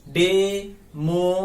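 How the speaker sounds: Democracy slowly split as डे, मो, क्रे, सी; four drawn-out beats in democracy; syllable by syllable. Shown as a demonstration. Teacher urges students to try it aloud, syllable by syllable. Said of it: "बोल के देखो ना".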